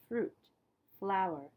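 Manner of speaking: ordinary delivery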